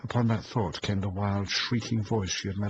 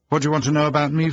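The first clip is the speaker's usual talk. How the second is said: teasing quip; 9 semitones higher, 11 dB louder, much faster than usual. A narrator's reading. Luke's teasing reply.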